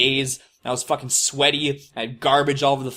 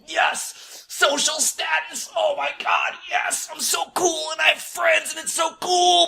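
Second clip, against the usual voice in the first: much higher, hammed-up shout of joy; mock triumphant cheer; 13 semitones higher, slower than usual. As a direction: squeal like a gamer who finally got status. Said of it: "And I'd be".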